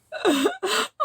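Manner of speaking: high, breathless laughing fit; helpless laugh. Real, not acted. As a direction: laugh until you can barely breathe. Laughing hard at the story.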